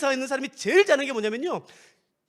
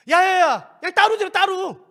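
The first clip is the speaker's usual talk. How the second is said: louder, higher dismissal; impatient brush-off; 9 semitones higher, 9 dB louder, slower than usual. Acted. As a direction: wave them off, worship apart. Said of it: "acted Roman church split".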